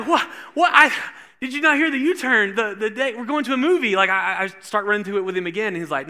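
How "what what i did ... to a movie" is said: high, loud disbelief, repeating what; retelling a stunned teen's outburst; incredulous protest. Retold or imitated. Disbelief at the speeding ticket.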